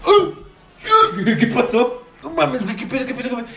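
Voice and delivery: sudden high yelps of pain, then panicky questions. Retold or imitated. Recalling stepping on a tack at work, high pained yelps. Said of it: "y yo así".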